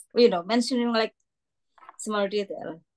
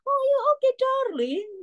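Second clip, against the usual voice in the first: very high cooing darling; old person on the street calling you darling; imitating London greeting. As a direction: coo it like a stranger on the street. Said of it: "people on the streets somehow call you".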